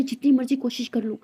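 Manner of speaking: loud, frustrated boss venting; angry yelling. A narrator's reading. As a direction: rant loudly in jealous frustration. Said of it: "boss चिल्लाते". (गुस्से में ज़ोर से बोलती हुई)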